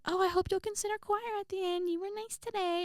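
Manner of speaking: very high, put-on sweet voice; gushing choir teacher. Choir teacher coaxing a student to join, gushing. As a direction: coax in a high imitation of the teacher.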